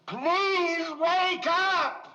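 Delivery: very high, loud, drawn-out wail; slow, high-pitched shout for help; desperate cry demo. Shown as a demonstration. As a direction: stretch each word into a pleading scream. Director demonstrates the desperate cry.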